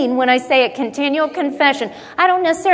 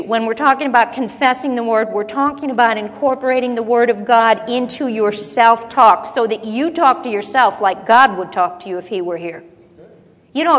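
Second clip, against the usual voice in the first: ordinary talk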